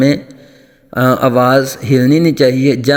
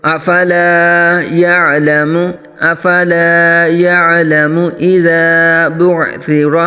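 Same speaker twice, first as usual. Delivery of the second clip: slow melodic tajweed chant; Quran recitation; 4 semitones higher, slower than usual. Shown as a demonstration.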